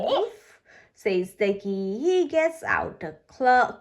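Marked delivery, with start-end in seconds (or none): 0.0-0.6 high questioning woof; a light rising bark like a friendly dog. Acted. Ducky answering the squirrels, a high rising woof.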